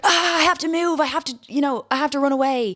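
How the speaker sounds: high, hammed-up panicky voice; mock panic. Imitating her own panic about moving, high and hammed-up. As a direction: squeal out a dramatic urge to flee. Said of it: "'cause I'm like".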